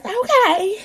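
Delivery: high, sweet-silly giving in; mock compliant. Mother giving in on the name, mock compliant. Self-mocking meek agreement.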